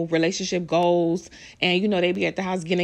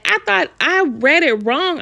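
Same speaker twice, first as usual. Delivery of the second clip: loud, higher and slowed in disbelief; incredulous; 7 semitones higher, 7 dB louder, slower than usual. Real, not acted. Vlogger rereading the headline, loud slow disbelief. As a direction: stretch it out, still shouting in shock. Outraged disbelief while reading news.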